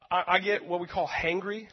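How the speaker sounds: normal talking voice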